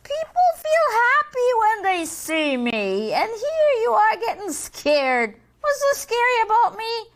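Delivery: high, smug devil. Acted. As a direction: tease like a vain devil. Devil character voice.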